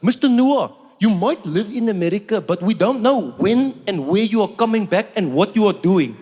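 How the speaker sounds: lightly put-on South African official accent; deadpan South African officer, matter-of-fact. Customs officer Johan answering without missing a beat, South African accent. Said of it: "is like".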